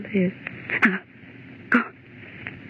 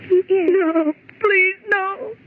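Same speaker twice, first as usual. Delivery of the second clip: higher, tearful pleading; crying with fear as he approaches; terrified crying; 6 semitones higher than usual. Acted. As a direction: sob out a panicked plea. Woman terrified he is coming.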